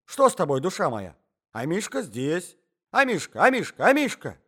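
put-on agitated old man calling a dog. A narrator's reading. Old husband coaxing Амишка from under the bed, calling out. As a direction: start concerned, then call loud and high. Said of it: "кричал старичок".

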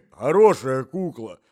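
loud, high, drawn-out word; cough-strained old man. Acted. Old husband describes a doll to his wife, straining between coughs.

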